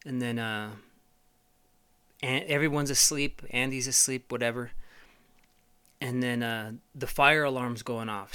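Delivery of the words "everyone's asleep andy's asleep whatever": normal talking voice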